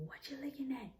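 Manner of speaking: high-pitched retold yell; mock aggressive yell. Boyfriend yelling at her off the bus, imitated aggressive high yell. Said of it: "started yelling at me like".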